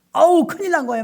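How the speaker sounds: loud, high alarmed outcry. Retold or imitated. Pastor reliving panic over the bleeding worker. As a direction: burst out in mock panic.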